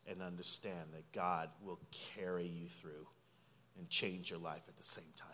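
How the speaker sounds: soft, intimate sermon voice sinking to a whisper; hushed preaching. Acted. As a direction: draw the congregation in close. Quiet closing exhortation.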